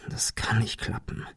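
whispered, doubtful aside; to himself. A narrator's reading. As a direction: whisper it under your breath, to yourself. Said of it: "jedoch mehr zu sich selbst". (leise geflüstert, zu sich selbst)

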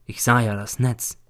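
usual speaking voice

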